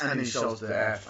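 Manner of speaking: usual speaking voice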